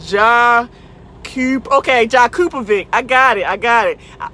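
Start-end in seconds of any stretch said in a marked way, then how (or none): 0.1-2.9 "Ja", "Kup-", then "Jakupovic" sounded out in slow separate syllables; sounding out a name. Shown as a demonstration. Streamer carefully sounds out a viewer's name "Jakupovic" syllable by syllable. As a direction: break "Jakupovic" into slow pieces before saying it whole. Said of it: "Let me slow down".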